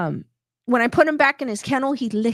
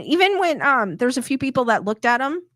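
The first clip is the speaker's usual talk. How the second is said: ordinary delivery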